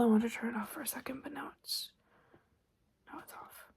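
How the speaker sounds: ordinary talk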